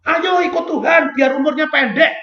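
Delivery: a bitter mock sermon line; bitter sarcasm. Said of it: "Saya ngomong-ngomong".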